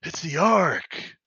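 hammed-up amazement, stretched out slowly; mock wonder, drawing out the words; Cheetor. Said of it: "And Cheetor's like".

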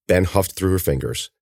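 ordinary delivery

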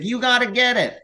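hammed-up pleading, high; whiny roommate. Roommate Phil begging Chris to fix the blinds, mock whine. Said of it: "He's like".

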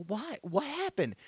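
shocked disbelief. Retold or imitated. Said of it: "I'm like".

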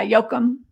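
Joachim said as YO-kum; Danish pronunciation. Shown as a demonstration. Guest confirms how the name is said, Danish pronunciation of Joachim. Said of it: "that's how you pronounce it".

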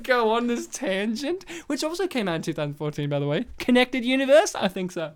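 usual speaking voice

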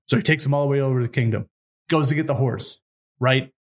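normal talking voice

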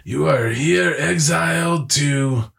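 high, slow, solemn pronouncement; mock sentencing judge. Friend imitating a judge exiling a convict, slow and solemn. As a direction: play a judge handing down exile. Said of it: "I knew what the word was".

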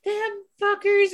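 high, slow and bitter; angry curse at the landlord, high and slow; angry muttered curse. Retold or imitated. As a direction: spit it out under your breath with disgust. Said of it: "And I'm like".

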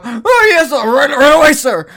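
high, loud, jumpy yes-sir reply; nervous eager young recruit. Acted. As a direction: scramble to obey the boss. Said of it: "And you hear them go".

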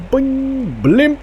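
high boing and a loud blimp, British officer; mouth blimp noise. Acted. Officer flicking the gas bag, doing a high boing sound. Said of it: "flicks it again".

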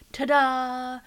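high, loud "ta-da" fanfare; showy reveal. Retold or imitated. Nurse unveiling the cleaned-up man to coworkers, showy. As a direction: present him like a magic trick.

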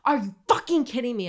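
high, loud furious yelling; outraged shriek of disbelief; retold furious scream. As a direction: scream it at him in disgust. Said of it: "I start screaming".

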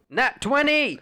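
high, loud triumphant yell; excited yell. Real, not acted. Player announcing a perfect dice roll, yelling. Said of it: "don't yell at me".

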